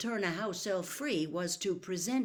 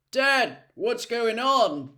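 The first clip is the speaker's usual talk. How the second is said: louder, higher, slow call; calling through the dark; 6 semitones higher, 10 dB louder, slower than usual. A narrator's reading. Charlie calling to his father from the tent, loud call. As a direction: shout it across the campsite. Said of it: "he called through the dark".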